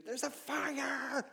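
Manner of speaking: high, slow mock cry of fire; mock alarm shout. Someone interrupting a reader by the pool, calling out in mock alarm. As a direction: call out a fire warning, hammed-up. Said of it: "somebody says to you".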